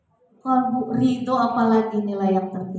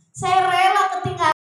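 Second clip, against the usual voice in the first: louder, higher eager cry; seruan bersemangat; 8 semitones higher, 9 dB louder than usual. Retold or imitated. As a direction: proclaim it with newfound joy. Murid berseru gembira pada ustaz. (murid berseru penuh semangat)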